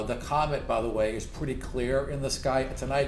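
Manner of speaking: normal talking voice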